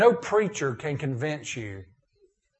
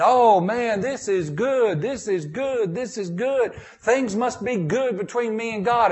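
high, loud, satisfied voice of a contented churchgoer; smug believer; 7 semitones higher, 3 dB louder than usual. Retold or imitated. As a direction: savor it like a man pleased with himself. Voicing complacent believers.

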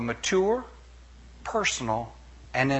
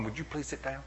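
quiet, laughing exasperated parent; a weary parent sighing it out quietly; 13 dB quieter, faster than usual. Retold or imitated. Preacher mimicking parents scolding kids, quietly exasperated. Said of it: "we spend the next twenty years going".

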